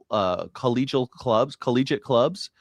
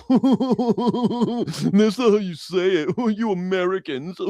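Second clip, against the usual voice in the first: high, slow mock English accent; hammed-up Brit scolding an American; mock English accent, laughing through it; 9 semitones higher, much slower than usual. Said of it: "you mimicking an English person".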